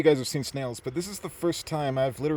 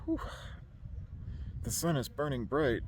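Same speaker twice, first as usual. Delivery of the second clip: out of breath; 10 dB quieter, much slower than usual. Real, not acted. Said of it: "my heart's beating".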